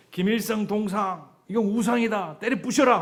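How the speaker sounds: high loud cry against the idol; shouted denunciation. Pretend. Imagined preacher in North Korea, loud high shout. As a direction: shout it out to smash the statue. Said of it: "하면".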